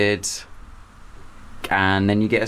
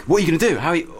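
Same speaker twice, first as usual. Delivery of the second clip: higher-pitched retold fretting; mock panic; 7 semitones higher, much faster than usual. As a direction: imitate flustered teachers, pitch up. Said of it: "everyone was like".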